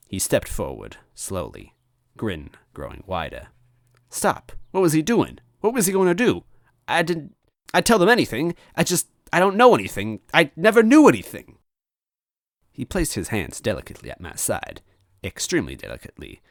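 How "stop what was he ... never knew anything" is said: high, loud frightened babble; panicked pleading. Acted. Captive Luigi pleading in fear. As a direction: beg in rising panic.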